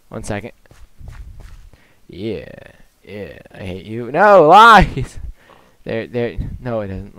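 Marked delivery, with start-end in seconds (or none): 4.1-5.2 loud teasing protest; playful shout. Real, not acted.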